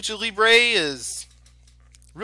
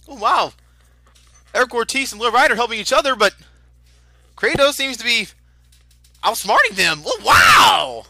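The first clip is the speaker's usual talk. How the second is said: loud, high excited shouting; lucha libre announcer; 6 semitones higher, 7 dB louder than usual. Real, not acted. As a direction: scream the call at the pinfall. Live play-by-play of the pinfall.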